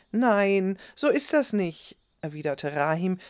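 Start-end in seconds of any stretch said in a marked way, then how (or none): 0.1-2.0 put-on higher-pitched, mildly cross boy's retort; getting cross. A narrator's reading. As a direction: protest as your temper rises. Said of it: "der nun ebenfalls sauer wurde". (zunehmend sauer widersprechend)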